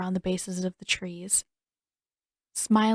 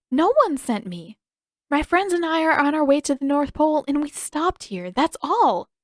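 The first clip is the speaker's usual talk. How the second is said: put-on young girl voice; 7 semitones higher, 4 dB louder than usual. Katara voiced, protesting.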